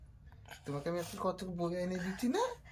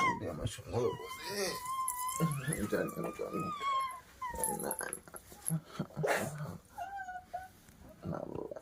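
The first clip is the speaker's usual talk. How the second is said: tender comfort; 6 dB quieter than usual. Real, not acted. Mother cooing to her crying boy, high and soft. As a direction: coo over him tenderly.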